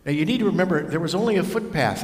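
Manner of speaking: usual speaking voice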